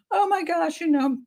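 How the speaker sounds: airy, high mock shrug; mock unconcerned. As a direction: mimic a careless parent, lightly. Mocking the Ramseys' unconcern.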